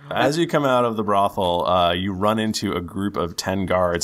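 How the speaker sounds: ordinary delivery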